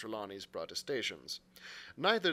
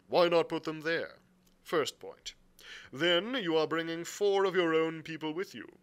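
louder, methodical captain's voice; blunt sea captain; 6 semitones higher, 8 dB louder than usual. Acted. Captain listing his demands to the gentlemen, bluntly. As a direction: set out each demand firmly. Captain's dialogue beside narration.

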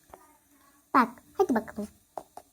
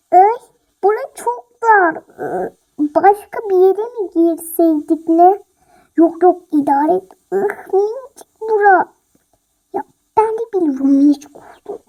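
loud cramped bickering; squabbling; 8 dB louder than usual. Acted. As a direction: complain loudly about the tight closet. Cramped in closet, bickering loudly. (dolapta sıkışmış, yüksek sesle atışma)

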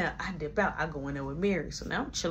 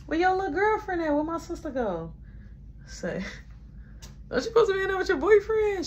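nosy prying voice; 12 semitones higher than usual. Retold or imitated. Tracy probing, storyteller snapping back.

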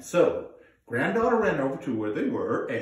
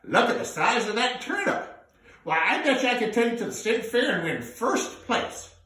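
high whooping cry, then higher excited voice; delighted grandfather; 9 semitones higher, 5 dB louder than usual. Acted. Grandfather crowing over his huge turnip. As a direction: marvel loudly at the giant turnip. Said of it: "looked at that thing and said".

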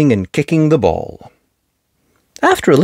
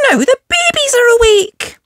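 high squeaky dismayed voice; little rabbit girl. Acted. Rebecca dismayed the babies woke, squeaky.

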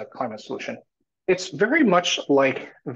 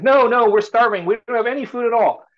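higher, louder pleading no; starving villagers; 9 semitones higher, 5 dB louder than usual. Retold or imitated. Villagers turning away the stranger, pleading. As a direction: protest there's no food at all.

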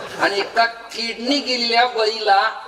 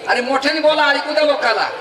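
higher and louder, urging the crowd; calling out; 8 semitones higher, 5 dB louder than usual. Real, not acted. Preacher calls to the women in the audience, loud. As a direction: push the audience to shout back.

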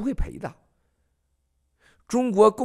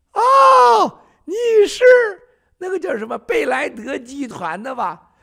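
much higher, louder put-on voice; mock Xi greeting; 13 semitones higher, 10 dB louder than usual. Mocking Xi greeting the American executive, high loud voice.

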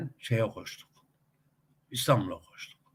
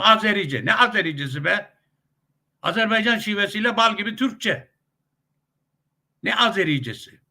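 higher, indignant dismissal; scornful objection; 8 semitones higher, 3 dB louder than usual. Real, not acted. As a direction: scoff at the very idea, pitch raised. Scoffing at the language label. (yüksek perdeden, itirazcı ve küçümseyen)

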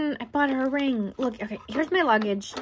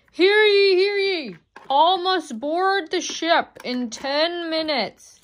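loud herald crying out the news; mock royal decree; 4 semitones higher, 4 dB louder, slower than usual. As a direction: proclaim it like a town crier. Town crier announcing boarding.

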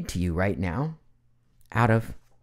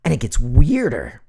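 ordinary talk

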